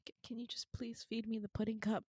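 small whispered begging; hushed plea. Retold or imitated. Patient begs friend for pudding, meek mock whisper. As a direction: beg meekly, almost without voice.

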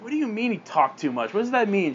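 high, stammered demand; upset questioning. Acted. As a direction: press mom for an answer, bewildered. Daughter confronts mom about dad.

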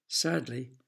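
plain reading voice

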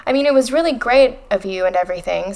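usual speaking voice

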